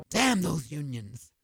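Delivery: usual speaking voice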